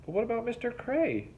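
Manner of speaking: high, worried call; worried cry. A narrator's reading. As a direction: cry out in concern. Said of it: "Tuna cried".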